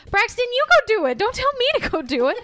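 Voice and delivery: loud and high protest; indignant shout. Pretend. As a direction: push back loudly. Arguing back at the character.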